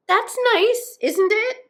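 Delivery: high, loud and cheery; cheerful, high-pitched comeback; cheerful reply. A narrator's reading. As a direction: chirp it back brightly. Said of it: "replied Two Tiki cheerfully".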